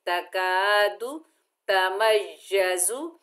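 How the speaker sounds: तकादु तमय्यजु recited slowly; slow recitation. Shown as a demonstration. Teacher reciting the phrase for students. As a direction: recite it clearly. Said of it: "आगे या मुशद्दद है".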